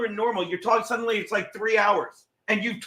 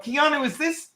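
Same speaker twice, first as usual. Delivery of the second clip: high, rising put-on question; mock query in a raised voice; mimicked woman asking; 7 semitones higher than usual. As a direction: imitate her asking, pitched well up. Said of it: "She's like".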